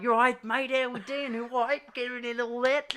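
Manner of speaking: mock Australian accent. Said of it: "And I'm just like".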